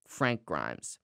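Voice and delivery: bitter seething correction, low and quiet; imitating Frank Grimes. Retelling Frank's rebuke of Homer, low seething impression. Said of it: "Frank goes".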